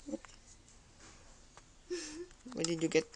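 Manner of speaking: ordinary talk, nothing marked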